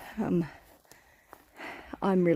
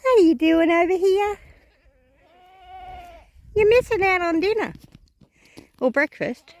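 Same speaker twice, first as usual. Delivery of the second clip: calling out; 11 semitones higher, 6 dB louder than usual. Real, not acted. Calling out to stray goat.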